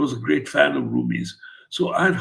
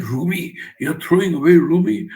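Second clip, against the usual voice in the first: outraged uncle; 5 semitones higher, 6 dB louder than usual. Retold or imitated. Uncle outraged at binned book.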